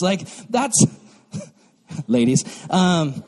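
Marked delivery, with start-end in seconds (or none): none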